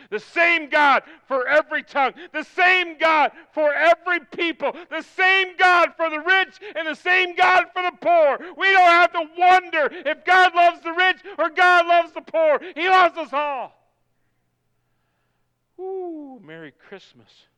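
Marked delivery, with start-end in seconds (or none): 0.1-13.7 high, shouted, rhythmic repetition; full-force preaching. Real, not acted. Preacher declaring God impartial to rich and poor, full-force preaching. 15.8-16.4 high "ooh" whoop; preacher's whoop. Real, not acted. Preacher after proclaiming God's love, high whoop.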